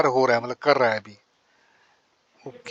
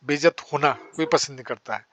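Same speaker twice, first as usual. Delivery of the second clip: ordinary talk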